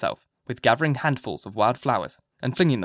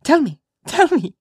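put-on very high, loud playful child; gleeful little-girl demand; capering child; 7 dB louder than usual. A narrator's reading. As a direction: chant it teasingly, no longer serious. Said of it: "laughing and capering about".

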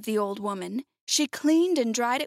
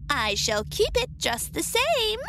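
high, slow gloating witch voice; mocking witch; 7 semitones higher than usual. A narrator's reading. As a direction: taunt her as the wicked witch, drawn out. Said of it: "laughing at her".

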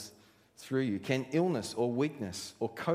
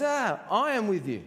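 high cut-in, then firm insistence; acted exasperated reassurance; God; 8 semitones higher, 4 dB louder than usual. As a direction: interrupt the excuses with an emphatic promise. God cuts off the excuses.

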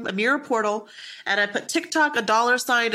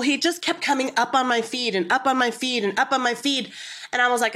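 ordinary delivery

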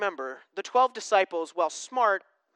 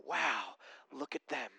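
awed whisper; 6 semitones lower, 14 dB quieter than usual. Retold or imitated. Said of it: "We all want people to look at us and go".

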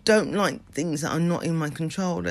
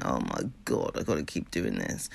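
reluctant groan; 11 semitones lower, 7 dB quieter than usual. Pretend. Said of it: "I say delicious state rather than".

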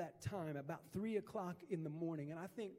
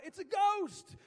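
shouted much higher and louder; terrified cry; 11 semitones higher, 11 dB louder, slower than usual. A narrator's reading. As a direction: cry it out in terror at a ghost. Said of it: "they cried out".

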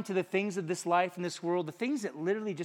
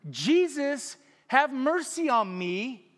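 louder, slower, drawn-out plea; crying out louder; 8 semitones higher, 7 dB louder, much slower than usual. A narrator's reading. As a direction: call out even harder than the first time. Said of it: "cried out all the louder".

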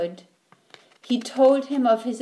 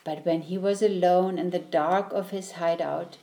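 ordinary delivery

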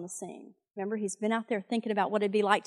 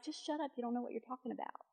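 mild mock brothers' scolding; 15 dB quieter, faster than usual. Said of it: "His brothers got mad at him".

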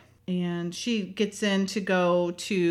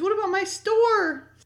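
put-on much higher, alarmed old shopkeeper voice; upset storekeeper; 11 semitones higher than usual. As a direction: cry out about his ruined store. Storekeeper's panicked question retold.

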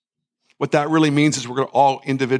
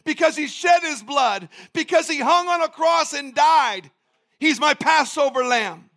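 rising preaching; 10 semitones higher, slower than usual. Acted. Preaching builds in pitch.

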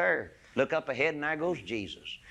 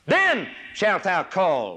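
slow, louder, drawn out; solemn proclamation; 6 semitones higher, 6 dB louder, much slower than usual. Acted. Preacher declaring the verse's condition, slow and solemn. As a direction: stretch each word with ringing weight.

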